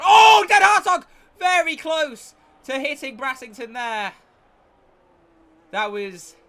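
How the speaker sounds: shrieked very high and loud; excited shout. Real, not acted. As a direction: yell out at a near crash. Near collision live.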